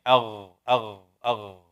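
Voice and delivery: أغ gargled from the throat; sounding out ghain. Shown as a demonstration. Teacher models غين for the students. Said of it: "غين".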